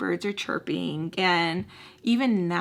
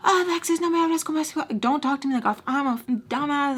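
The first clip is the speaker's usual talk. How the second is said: so mad; 7 semitones higher, faster than usual. Retold or imitated. Angry man telling Alexis off, heated mock scolding. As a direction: scold in heated Spanish and English, voice raised. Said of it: "he's so mad".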